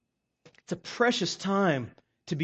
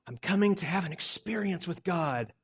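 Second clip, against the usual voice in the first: mocking quote; 4 semitones lower, 7 dB quieter than usual. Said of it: "what I really hate is the phrase".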